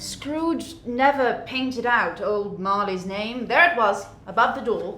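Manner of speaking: dramatic read-aloud; story narration. A narrator's reading. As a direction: paint the scene. Narration continues.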